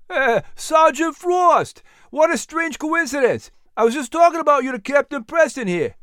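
high-pitched, excited greeting; delighted surprise. Acted. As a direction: greet an old friend, voice leaping up. Henry greets old friend with surprise.

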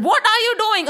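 high, startled protest; indignant serpent. Acted. Serpent protests at being bounced, high and indignant. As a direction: complain in a high voice. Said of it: "the serpent said".

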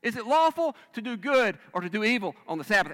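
loud indignant challenge; Jesus angrily questioning the watching crowd; angry. Retold or imitated. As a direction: confront them with a righteous anger. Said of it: "And he's angry".

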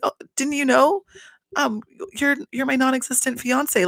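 high, fake-innocent imitation; petty, high-pitched retelling; mock-innocent pettiness. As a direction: play coy in a high voice. Acted petty reply, high voice.

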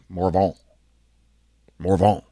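Morvant with the final T dropped, ending on an open vowel; Cajun French Morvant. Shown as a demonstration. Showing listeners his real name, Morvant the French way. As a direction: give Morvant its French sound, no T at the end. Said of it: "the T is almost silent".

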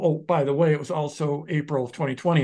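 usual speaking voice

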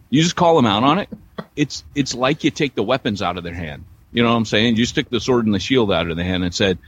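ordinary talk, nothing marked

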